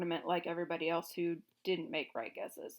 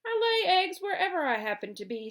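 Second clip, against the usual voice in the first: higher, louder hen voice; matter-of-fact hen; 10 semitones higher, 7 dB louder than usual. A narrator's reading. Billina coolly telling the king, higher and louder. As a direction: say it as plain fact.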